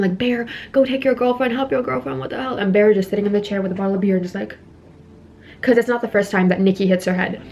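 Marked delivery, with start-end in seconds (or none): 0.2-2.6 higher-pitched hushed mock yell, fading; mock screaming. Screaming crowd urges Bear to help his girlfriend, higher-pitched mock yell. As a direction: mimic a crowd's yelling without the volume. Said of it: "they're, like, screaming".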